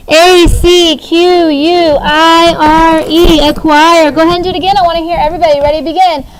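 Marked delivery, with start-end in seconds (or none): 0.1-3.5 letter by letter, slow chant for the class; slow spelled-out letters. Shown as a demonstration. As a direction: spell each letter slowly and clearly.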